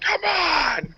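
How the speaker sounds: high-pitched mock yell up high; retold yell. Friend chained to toilet yelling at the roof, mock yell. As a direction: imitate someone yelling far overhead. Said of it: "yelling up at the roof".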